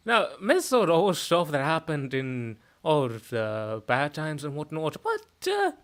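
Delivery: soft Irish lilt; Irish accent. Shown as a demonstration. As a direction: talk on the soft end in Irish. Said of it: "a little bit more on the soft end".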